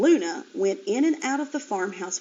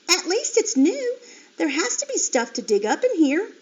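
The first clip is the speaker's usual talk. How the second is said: high, eager piglet voice; high piglet voice exploring; 8 semitones higher, 3 dB louder than usual. A narrator's reading. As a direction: perk up and sound curious. Flora exploring new pen.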